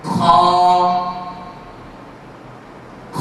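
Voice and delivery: খ thick and heavy from the upper throat; a heavy throaty খ with open mouth; letter name Kha. Shown as a demonstration. Teacher models heavy খ for the class. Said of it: "এই হরফটির নাম হচ্ছে".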